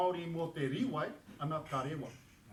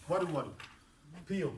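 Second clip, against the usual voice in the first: ordinary talk, nothing marked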